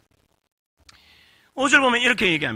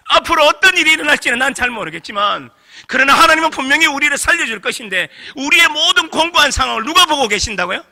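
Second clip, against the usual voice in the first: fervent preaching; 7 semitones higher, 6 dB louder than usual. Real, not acted. Preaching climax.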